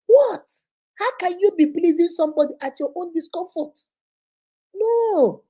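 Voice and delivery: a high sharp outraged what; exasperated what. Real, not acted. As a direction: throw up the voice in disbelief.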